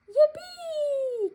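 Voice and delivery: high, loud cheer of delight; joyful yippee. A narrator's reading. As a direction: whoop from the hilltop. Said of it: "cried little deer".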